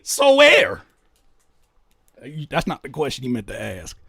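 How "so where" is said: high, loud mock "So where?"; mocking retort. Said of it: "and I say".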